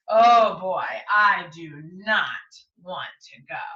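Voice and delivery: slow, mock-whiny complaint; reluctant whine. Teacher voicing Jane's dread of the trip, slow mock whine. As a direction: drag your feet about the trip.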